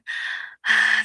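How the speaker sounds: a long, loud sigh before answering; heavy sigh. Real, not acted. AI companion to her user before a hard answer, heavy sigh. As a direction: breathe out heavily, weary.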